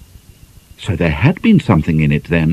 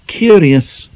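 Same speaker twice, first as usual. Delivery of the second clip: high, louder single-word musing; musing remark; 9 semitones higher, 4 dB louder than usual. A narrator's reading. Chatford musing aloud to the ladies, pointed. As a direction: drop the word knowingly.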